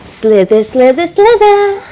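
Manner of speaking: sing-song slither, climbing in pitch; rising, sinuous chant; playful slithering snake. Pretend. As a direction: slide the words along like a snake.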